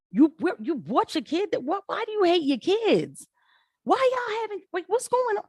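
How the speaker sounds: high, incredulous rant at the parents; exasperated disbelief. Real, not acted. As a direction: pitch it way up in disbelief. Ranting at parents of kids.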